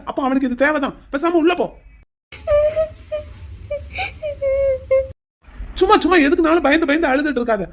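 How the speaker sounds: irritated scolding. Acted. Husband scolds his crying wife.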